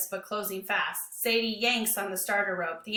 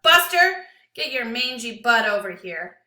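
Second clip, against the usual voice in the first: booming call; 9 dB louder than usual. Acted. Lewis ordering the dog to his side, booming. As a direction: boom it across the yard at the dog. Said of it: "Lewis's voice booms out".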